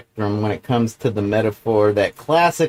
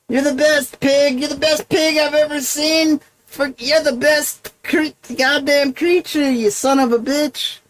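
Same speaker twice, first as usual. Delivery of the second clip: much higher, gushing praise voice; gushing farmer; 13 semitones higher than usual. Retold or imitated. Farmer secretly gushing over his pig, much higher. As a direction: rave at the pig like it's a miracle. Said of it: "he's really saying".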